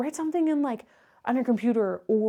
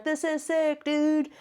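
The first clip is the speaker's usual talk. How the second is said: mock bro; 4 semitones higher, slower than usual. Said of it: "you're like".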